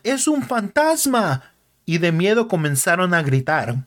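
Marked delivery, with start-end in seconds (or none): 0.0-1.4 higher, slower cry of mild alarm; mildly frightened. A narrator's reading. Frightened disciples see a figure on the sea, mildly alarmed. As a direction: read it with a touch of fear, pitch raised. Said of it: "tuvieron miedo y decían".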